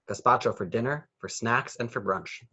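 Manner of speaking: plain reading voice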